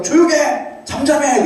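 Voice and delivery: high-pitched mock yell of a scolding crowd; 군중의 꾸짖는 외침. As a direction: imitate people shouting someone down, pitched well up. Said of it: "사람들 목소리가 들리는데, 소리 지르면서". (높은 음으로 흉내 낸 꾸짖는 고함)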